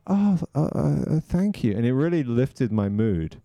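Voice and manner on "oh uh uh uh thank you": high, halting stammer; acted flustered surprise, pitched up; shocked. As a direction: stumble into thanks, caught off guard. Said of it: "And I was so shocked".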